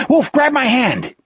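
restrained high-pitched yell. A narrator's reading. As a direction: call out in alarm, pitch jumping up. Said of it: "he yelled".

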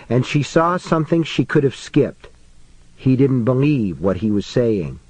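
ordinary delivery

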